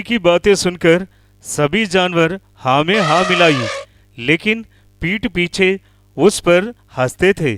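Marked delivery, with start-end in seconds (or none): none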